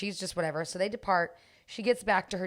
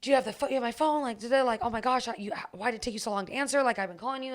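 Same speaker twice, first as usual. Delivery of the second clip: put-on fast, higher, rushed nagging boyfriend; frantic boyfriend; 5 semitones higher, faster than usual. Boyfriend pressing his girlfriend on the phone, fast and anxious. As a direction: rattle it off like a panicky man. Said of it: "Oh, like".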